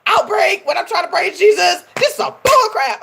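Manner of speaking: retold outrage. Vlogger replaying her complaint to Jesus, outraged. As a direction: rant at the bad timing. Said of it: "I was like".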